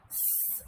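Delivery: long voiceless hissing S; a soft, drawn-out snake hiss. Shown as a demonstration. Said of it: "Bunyi S macam bunyi ular".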